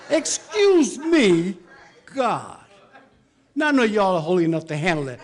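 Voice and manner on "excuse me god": "Excuse me" stretched slow and loud, high; slow, loud outraged repeat; drawn-out excuse me. Acted. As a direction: drag out "Excuse me" in disbelief.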